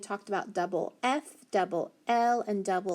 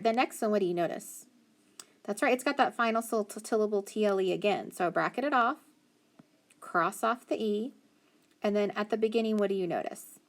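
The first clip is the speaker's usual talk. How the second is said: ordinary delivery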